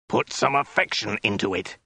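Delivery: understated roared order; mild roar. A narrator's reading. Soldier correcting the captive woman, mildly roared. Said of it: "he roared".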